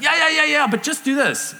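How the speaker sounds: high, loud, hurried brush-aside; impatient problem-solver. Acted. Husband cutting in on wife with solutions, impatient. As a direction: cut in eagerly with a quick fix.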